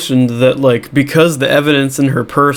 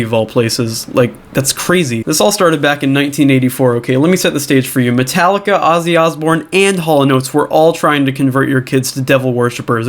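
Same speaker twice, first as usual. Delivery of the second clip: usual speaking voice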